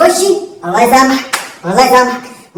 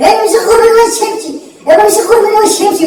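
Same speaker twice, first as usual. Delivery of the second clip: higher, louder outraged shout; mock yelling; 8 semitones higher, 4 dB louder than usual. Student shouting at teacher to leave. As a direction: yell back at the teacher.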